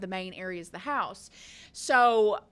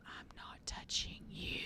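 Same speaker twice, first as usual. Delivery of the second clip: silent mouthed taunt; voiceless whisper, just lips moving; mouthed silently; 13 dB quieter than usual. Retold or imitated. Sister silently taunting brother in the car, mouthed whisper. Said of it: "and I mouth".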